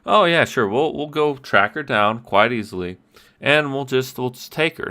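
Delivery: sarcastic carefree player voice; mock breezy overconfidence. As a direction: toss it off as if it's easy. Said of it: "players can just".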